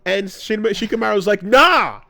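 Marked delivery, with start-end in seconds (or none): 1.4-2.0 higher, louder, no-nonsense brush-off; a hammed-up Shikamaru waving it off; blunt dismissal. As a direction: shut it down, he's gotta go. Shikamaru voiced, firm dismissal.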